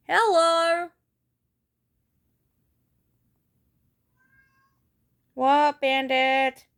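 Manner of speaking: loud, high sing-song hello to the cat; high pet voice. Real, not acted. Speaking to her cat Bandit, high loud pet talk.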